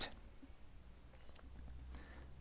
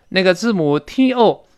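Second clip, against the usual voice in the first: normal talking voice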